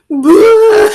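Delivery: high, loud mock bawl; exaggerated "boo-hoo" outburst; imitated sobbing wail. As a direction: burst out in a pretend sob. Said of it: "he just started sobbing".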